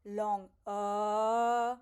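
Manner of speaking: drawn-out अ, held long; sustained a sound; long a vowel. Shown as a demonstration. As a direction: stretch the अ to show a long vowel. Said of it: "दीर्घ मतलब".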